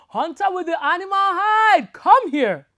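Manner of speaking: loud, high booming call; bellowing. Acted. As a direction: bellow from inside the tree. Tree calls the hunter.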